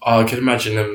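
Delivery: ordinary talk, nothing marked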